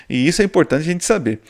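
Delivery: normal talking voice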